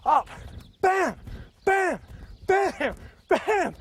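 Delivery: high, energetic shouts with each bounce; excited calls. Real, not acted. Bouncing on a trampoline, calling out the moves.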